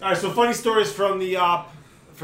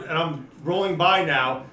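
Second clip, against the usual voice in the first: ordinary talk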